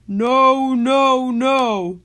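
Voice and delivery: high, loud villain shout. A narrator's reading. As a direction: bellow a stubborn refusal. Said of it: "And Paro shouted".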